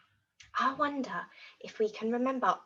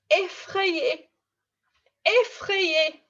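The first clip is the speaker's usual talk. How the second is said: higher, a little hammed-up fear; mock scared; 7 semitones higher, 4 dB louder, much slower than usual. Shown as a demonstration. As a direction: act frightened. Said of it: "How about the word for scared?".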